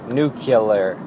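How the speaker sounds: "nuclear" with an added middle syllable, "NOO-kih-ler"; extra syllable. Shown as a demonstration. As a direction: put a third syllable into "nuclear". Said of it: "my brain wants to add a third syllable".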